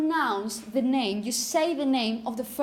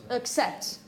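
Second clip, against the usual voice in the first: "Accept" with a weak, tired schwa first and the weight on the end; "Accept", first vowel reduced to "uh", second syllable stressed. Shown as a demonstration. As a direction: throw the emphasis onto the second syllable of "Accept". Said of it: "you throw all the emphasis on the second one".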